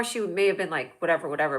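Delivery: usual speaking voice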